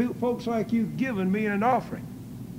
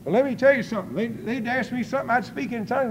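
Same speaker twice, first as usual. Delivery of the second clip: ordinary talk, nothing marked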